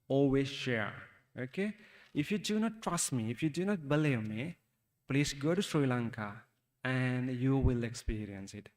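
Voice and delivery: usual speaking voice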